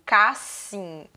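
"cassim" as CAS-SIM with a hissed S, not Z; beginner's misreading. Retold or imitated. New reader attempting the word casinha, beginner's misreading. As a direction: sound out cassim in two halting syllables. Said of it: "De cara ele vai começar assim".

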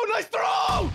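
high rising excitement; excited cheer. Real, not acted. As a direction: cheer your teammate on. Praising teammate's grenade throw.

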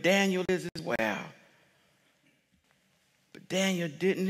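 quiet intimate sermon passage; hushed preaching. Acted. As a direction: draw them in quietly.

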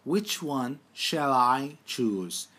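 very slow repeat of the question; drawn-out teacher repeat; slow dictation. Shown as a demonstration. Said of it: "Bakın birinci hali".

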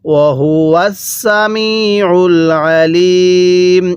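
slow, drawn-out tajweed chant; Quran recitation. Shown as a demonstration. Teacher recites the verse for students, slow tajweed chant. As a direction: intone each syllable slowly like a reciter. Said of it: "এরকমভাবে পড়ব".

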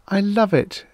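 high, delighted she-creature voice; happy. A narrator's reading. Airy creature loving her new world, happy. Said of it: "she said happily".

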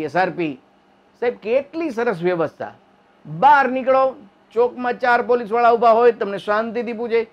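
ordinary delivery

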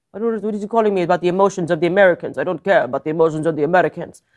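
fast, mock-indignant Russian accent; bad Russian accent. Putin scoffing at American emotions, bad Russian accent. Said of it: "very bad Russian accent".

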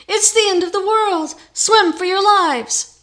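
high, loud panicked shriek, slowing at the end. A narrator's reading. Carpenter shrieking at the neighbors in panic. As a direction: shriek it like the world is ending. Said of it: "The carpenter was shrieking".

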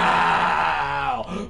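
long, loud hammed-up holler; mock falling yell. Player imitating the thrown crook, drawn-out mock scream. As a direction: yell as if flung through the air. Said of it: "And he goes".

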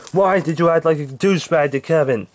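ordinary delivery

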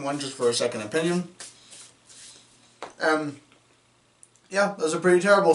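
speaking around soft candy; chewing while talking. Real, not acted. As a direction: mouth partly full. Seen 0: Ate second peep just before; closed-mouth chewing visible while speaking.

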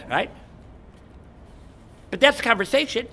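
usual speaking voice

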